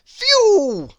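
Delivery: high, loud whooshing phew; relieved gasp. A narrator's reading. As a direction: blow out a huge relieved phew. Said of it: "gasped the elephant".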